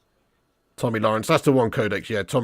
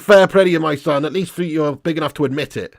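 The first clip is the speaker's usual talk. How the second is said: usual speaking voice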